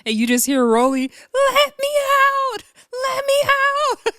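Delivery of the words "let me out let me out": imitated screaming. Mimicking Rolly begging off the bus, laughing high scream. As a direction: wail it like you're trapped. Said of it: "the way this girl was screaming to let, to be let out".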